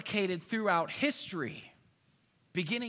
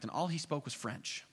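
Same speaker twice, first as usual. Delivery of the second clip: normal talking voice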